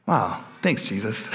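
ordinary delivery

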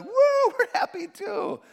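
high, loud happy whoop; cheering woo. Retold or imitated. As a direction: cheer along with the happy kids. Kids happy, parent cheering.

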